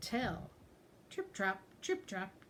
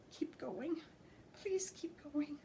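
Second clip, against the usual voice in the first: soft pleading whisper, higher; 8 semitones higher, 6 dB quieter, slower than usual. Acted. Scared ogre hopes the goat passes, pleading whisper. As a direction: plead under your breath. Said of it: "Little Ogre whispered".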